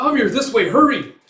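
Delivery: high, loud hurrying shout; urgent call. Retold or imitated. Calling Amir away from gunfire, urgent shout. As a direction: urge someone to escape.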